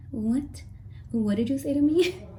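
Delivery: high, stunned question; shocked disbelief. Retold or imitated. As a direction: react as if you misheard. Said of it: "I was like".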